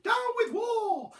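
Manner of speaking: high, loud, drawn-out hoot; high honking protest. A narrator's reading. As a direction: hoot the slogan slowly up high. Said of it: "he hooted".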